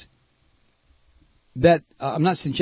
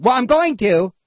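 put-on higher, louder childish comeback; mock petulant retort; 8 semitones higher, 4 dB louder than usual. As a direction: sneer it like a stubborn kid. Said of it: "this junky-ass game of".

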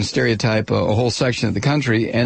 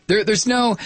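ordinary delivery

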